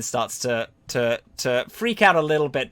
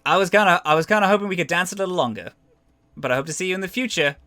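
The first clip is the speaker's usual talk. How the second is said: high, quick, louder character voice; Flare; 5 semitones higher, 4 dB louder, much faster than usual. Acted. Game master as Flare saying goodbye to an opponent, raised quick voice. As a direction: speed through a parting line in a raised voice. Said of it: "Flare's like".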